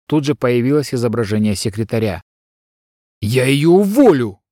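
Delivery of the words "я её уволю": loud furious roar. A narrator's reading. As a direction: bellow it in fury. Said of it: "взревел Адрик".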